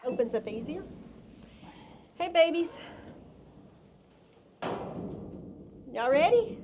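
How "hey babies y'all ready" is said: high, louder sing-song call to the babies; high coaxing call to fawns. Real, not acted. Rehabber calling her fawns out of the trailer, high and loud.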